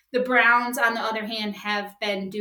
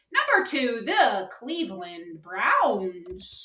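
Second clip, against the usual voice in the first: ordinary talk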